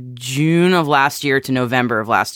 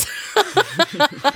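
high, laughing Canadian "Force"; Canadian accent; 12 semitones higher than usual. Shown as a demonstration. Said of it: "Can you do that again?".

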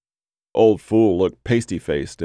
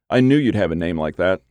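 usual speaking voice

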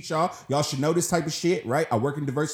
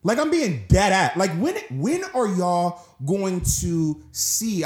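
heated, raised pitch, drawn-out words; real upset; 4 semitones higher, slower than usual. Real, not acted. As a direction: demand action, slow and exasperated. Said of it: "I'm getting so upset".

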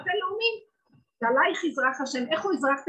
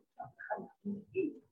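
quiet slow admission; 10 dB quieter, much slower than usual. Retold or imitated. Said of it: "זה כמו".

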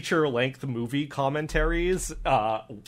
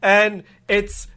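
ordinary talk, nothing marked

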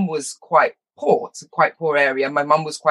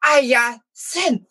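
very slow, higher mock-posh Jamaican accent, H dropped; 8 semitones higher than usual. Mimicking speaky spokies who miss the H, posh Jamaican accent. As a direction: stretch out Hyacinth in an affected Jamaican accent. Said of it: "they're trying to be posh".

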